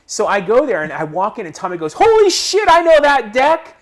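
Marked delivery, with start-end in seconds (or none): 1.9-3.7 high, loud astonished outburst, slowing on the deck line; excited exclamation. Retold or imitated. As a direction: shout it like you can't believe your eyes. Tommy recognizes his deck.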